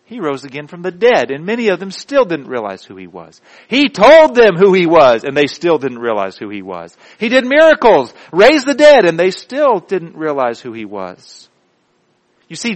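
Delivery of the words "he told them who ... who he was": preaching at full force. Real, not acted. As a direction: hammer each claim home, then drop in pitch.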